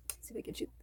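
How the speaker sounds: soft whisper to herself; real whispered aside. Real, not acted. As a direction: murmur it under your breath while filming. Aside while filming the book.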